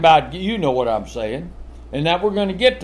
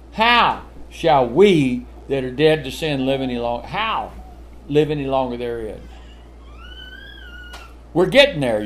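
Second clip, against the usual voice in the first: normal talking voice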